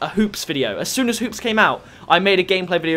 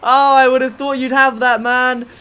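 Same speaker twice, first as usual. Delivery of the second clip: loud, high laughing wail; high-pitched amused complaint; laughing dismay; 6 semitones higher, 5 dB louder than usual. Real, not acted. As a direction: laugh it out in disbelief at your mate. Laughing after teammate's miss.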